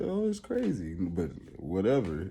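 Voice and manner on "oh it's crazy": high, soft mocking "Oh, it's crazy"; tossing off "it's crazy" in a high, hushed voice; a hint of a dismissive bystander; mock quote. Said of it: "You say".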